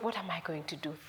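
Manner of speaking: low, very soft and downcast; hushed, low worry; sad murmur. Acted. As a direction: say it quietly to yourself, defeated. Sad son wonders what to do.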